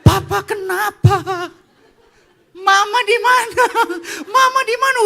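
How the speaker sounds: loud, high panicked cry; acted-out shock, shouted. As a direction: blurt it out in alarm, voice shooting up. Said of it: "gantian saya yang syok".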